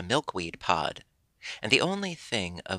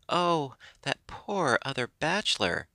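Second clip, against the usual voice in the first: higher, lightly sobbing lament; a hint of tearful pity, pitched up; mock sob; 6 semitones higher than usual. Bride snake lamenting the beaten rival, mildly tearful. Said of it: "she sobbed".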